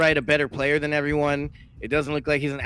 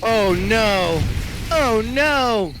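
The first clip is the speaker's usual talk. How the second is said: dismayed; 5 semitones higher, 3 dB louder, much slower than usual. Real, not acted. Watching units die.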